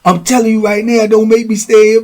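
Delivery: high, worked-up imitated threats; acted jealous accusation building to threats; jealous outburst. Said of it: "he started going into".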